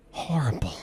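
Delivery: ordinary talk, nothing marked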